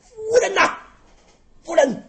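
loud, high anxious calls of a worried husband; calling out. Acted. Husband calling to his crying wife, loud and high. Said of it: "连声呼喊".

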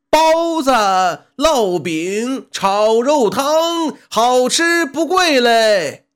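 high, drawn-out sing-song street cry; loud hawker's cry. A narrator's reading. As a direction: call out the menu to passers-by, slow and singing. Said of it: "跑堂的也总是大声喊着". (拖长声调的高声吆喝)

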